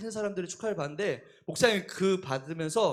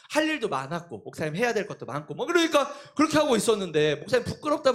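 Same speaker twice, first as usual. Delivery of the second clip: usual speaking voice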